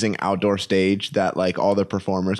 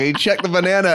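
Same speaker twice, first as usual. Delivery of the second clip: ordinary talk, nothing marked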